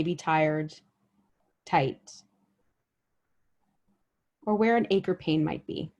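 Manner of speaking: unhurried meditation guide, long pauses between phrases; guided body scan meditation. Real, not acted. Guide helping listeners notice their bodies with each breath, guided meditation. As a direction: lead them gently through the body, letting each phrase settle.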